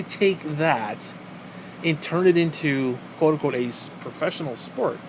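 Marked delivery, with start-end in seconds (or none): none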